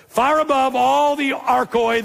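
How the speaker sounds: "Far above" held long and high; emphatic scripture reading. Real, not acted. Preacher reading the ranks of powers to congregation, emphatic.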